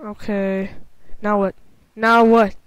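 normal talking voice

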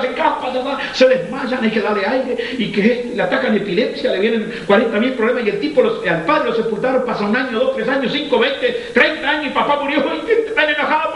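predicación encendida en tono alto; predicación intensa. Acted. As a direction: predica con fuerza y voz alta. Predica con fuerza en alto. (predicador exaltado hablando de padres e hijos)

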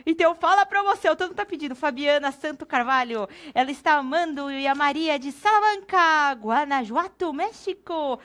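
shopping channel announcer; hyped promo read. Acted. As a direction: sell it like a TV host.

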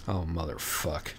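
soft, deflated sighed curse; weary sigh. A narrator's reading. Dirk sighing at the cat's question, soft and weary. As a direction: let it out on a tired sigh. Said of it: "Dirk sighed".

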